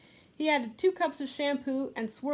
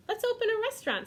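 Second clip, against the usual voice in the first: very high, fast, eager suggestion; excited girl; 12 semitones higher, 4 dB louder, faster than usual. Acted. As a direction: burst out with the idea. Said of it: "said Louise".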